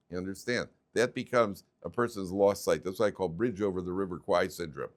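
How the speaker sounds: ordinary talk, nothing marked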